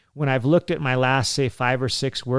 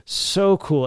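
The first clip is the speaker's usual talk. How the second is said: excited; 6 semitones higher, 4 dB louder than usual. Real, not acted. Repeats with excitement.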